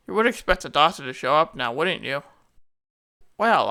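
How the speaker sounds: normal talking voice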